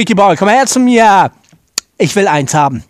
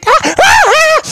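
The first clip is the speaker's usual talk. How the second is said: high shout; much slower than usual. Acted. Shouting in a fight.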